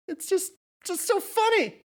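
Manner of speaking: high laughing voice. A narrator's reading. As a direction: giggle it out hysterically. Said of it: "she laughed".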